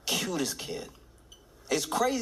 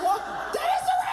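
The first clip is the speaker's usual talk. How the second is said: high, loud, amazed shout; excited shout; 3 dB louder, much faster than usual. Retold or imitated. Recognizing Serena on stage, high excited shout. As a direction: yell in delighted shock. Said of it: "I was like".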